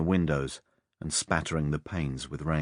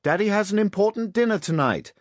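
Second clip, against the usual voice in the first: high, loud call to another room; a busy mother shouting back to her child; shouted back; 6 dB louder than usual. A narrator's reading. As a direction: holler it at raised pitch. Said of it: "she shouted back".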